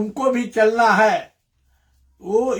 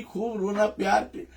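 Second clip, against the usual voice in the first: ordinary talk, nothing marked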